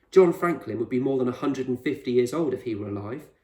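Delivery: usual speaking voice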